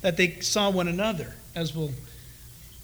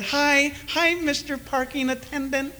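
1 fake cheerful greeting; 6 semitones higher than usual. Fake cheerful churchgoer at parking lot.

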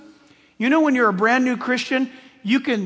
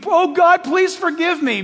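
pleading sinner; 7 semitones higher, 4 dB louder than usual. Retold or imitated. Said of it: "and you, you".

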